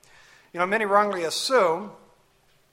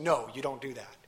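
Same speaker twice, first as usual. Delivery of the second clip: firm refusal; 5 semitones lower, 6 dB quieter than usual. Retold or imitated. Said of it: "No, that's saying".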